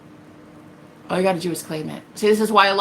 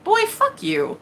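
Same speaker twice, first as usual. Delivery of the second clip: sassy retort; 12 semitones higher, slower than usual. Retold or imitated. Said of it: "And I was like".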